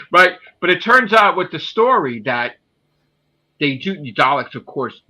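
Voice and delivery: ordinary talk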